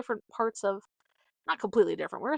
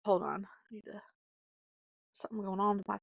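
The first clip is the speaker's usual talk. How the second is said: whispered aside; 5 dB quieter, faster than usual. Real, not acted. Aside while fixing face.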